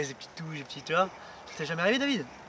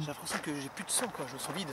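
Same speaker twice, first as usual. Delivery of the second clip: soft, drained murmur; weak and drained; 3 semitones lower, 13 dB quieter than usual. Acted. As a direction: say it like all your strength is gone. Drained after possession.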